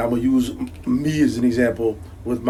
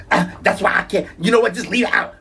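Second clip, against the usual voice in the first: higher, exasperated imitation of the wife; mock wife voice; 8 semitones higher than usual. Husband mimics wife giving up on the ketchup argument, high exasperated voice. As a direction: toss it off like the wife giving in, mocking.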